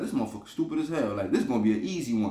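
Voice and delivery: quick, scornful inner voice; retold private thought. Friend sizing up his target in his head, voiced quickly. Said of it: "he thinking in his head".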